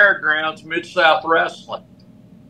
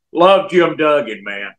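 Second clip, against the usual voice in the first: normal talking voice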